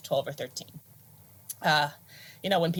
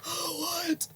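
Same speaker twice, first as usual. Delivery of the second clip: awed gasp; 11 semitones higher, 7 dB quieter than usual. Retold or imitated. Awed little kid at concert.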